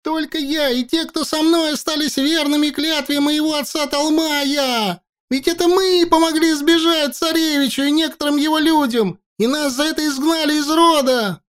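высокий напряжённый крик; кричать, чтобы слышали все; крик во всё горло. A narrator's reading. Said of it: "Учай кричал во всё горло". (крик во всё горло)

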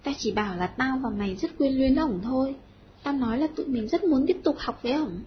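ordinary delivery

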